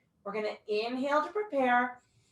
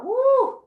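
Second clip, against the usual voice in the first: breathy woo; 12 semitones higher, 6 dB louder than usual. Real, not acted. Exhale after hard core work.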